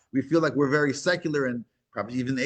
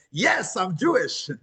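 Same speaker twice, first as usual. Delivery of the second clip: high, long-drawn joyful exclamation; bursting out; 10 semitones higher, much slower than usual. Retold or imitated. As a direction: burst out with it, pitched high and stretched. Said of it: "burst out and saying".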